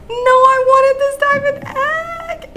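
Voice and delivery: high, slow, drawn-out wail; anguished wail. Real, not acted. To viewers over the lost diamond egg, a high slow wail.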